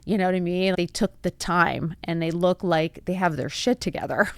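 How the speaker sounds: ordinary delivery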